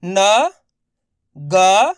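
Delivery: न and ग called out loud one by one; letters spelled out. Shown as a demonstration. Teacher spells न and ग aloud for pupils. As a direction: sound out each letter clearly. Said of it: "देखो किस तरीके से है".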